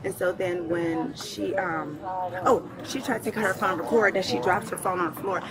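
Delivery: ordinary talk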